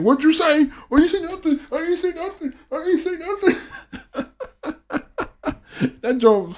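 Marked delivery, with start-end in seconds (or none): 0.0-3.5 high-pitched, repeated mock denial; imitating a backtracking heckler. Fan quoting a caught-out heckler, high backpedalling voice. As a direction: climb higher and back off in volume with each denial. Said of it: "he's like".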